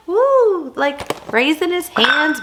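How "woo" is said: high loud party woo; cheering woo. Retold or imitated. Voicing the party ghost figurine, excited woo. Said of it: "he's like".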